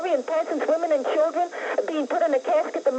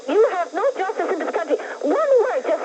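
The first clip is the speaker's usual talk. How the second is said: screaming; 6 semitones higher than usual. Real, not acted. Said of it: "Why are you screaming?".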